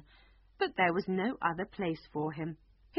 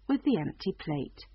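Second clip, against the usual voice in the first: usual speaking voice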